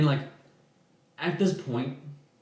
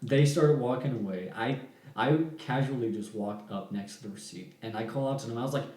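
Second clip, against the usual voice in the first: ordinary delivery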